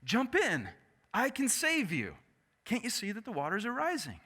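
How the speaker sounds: calling out high across the water; shouted offer of rescue. Retold or imitated. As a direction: call out to him from the boat. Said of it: "he shouts out to him".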